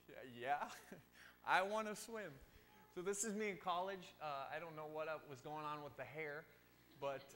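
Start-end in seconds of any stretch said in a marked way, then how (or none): 0.1-2.3 laughing, high and loud on the end; eager reply. Retold or imitated. Preacher replays his answer to a swim invitation, eagerly. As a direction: burst into keen agreement, voice jumping up.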